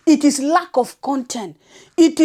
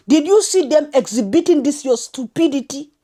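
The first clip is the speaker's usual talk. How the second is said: usual speaking voice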